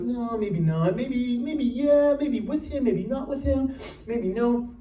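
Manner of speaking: mock the indecision; waffling studio exec. Fox executives dithering over casting, slow and indecisive. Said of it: "Fox was like".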